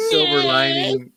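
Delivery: put-on high, slow old woman voice; old lady voice. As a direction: quaver it out like a granny. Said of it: "Do you like my old lady voice?".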